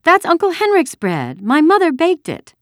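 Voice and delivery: put-on high, loud indignant girl; angry. A narrator's reading. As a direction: protest hotly as an outraged child. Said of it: "she said angrily".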